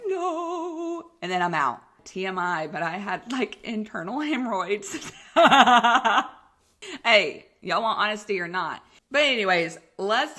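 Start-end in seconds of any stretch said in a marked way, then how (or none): 0.1-1.1 high squeaky protesting no; mock panic no. Patient protesting as the anesthesia mask goes on, high squeak. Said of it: "And I'm like". 5.3-6.3 loud laugh. Real, not acted. Laughs after TMI confession.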